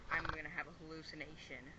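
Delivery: ordinary talk, nothing marked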